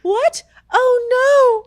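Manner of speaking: high, loud shocked gasp of words; shocked neighbor. Retold or imitated. Neighbor hearing about the lost finger, loud shocked cry. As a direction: shriek the surprise as the neighbor. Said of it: "she's like".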